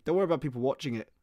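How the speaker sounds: ordinary talk, nothing marked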